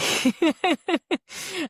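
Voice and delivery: bright burst of real laughter. Real, not acted. Author laughing about her own unrealistic plot, bright burst of laughter. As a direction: laugh at how far-fetched it is.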